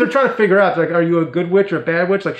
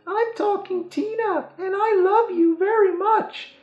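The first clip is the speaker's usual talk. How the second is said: creepy doll voice; slower than usual. Retold or imitated. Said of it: "It says".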